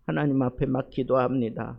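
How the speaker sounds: ordinary delivery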